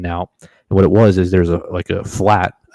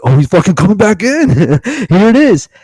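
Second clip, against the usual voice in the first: much higher, louder thrilled voice; high excited retelling, slowing at the end; excited disbelief; 12 semitones higher, 7 dB louder than usual. Retold or imitated. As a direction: squeal it out like you can't believe it. Deer coming back into range.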